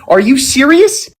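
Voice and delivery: high, slow, can't-believe-it question; incredulous. Real, not acted. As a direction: stretch it out in disbelief. Disbelief at salary guess.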